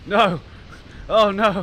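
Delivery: loud, high cry of no; dismayed shout. Real, not acted. Player reacting to the game, loud dismayed shout.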